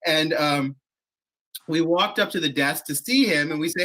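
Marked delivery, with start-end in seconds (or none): none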